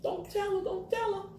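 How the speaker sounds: conspiratorial, keep it secret; playful secret plea. Real, not acted. Asking viewers to keep secret.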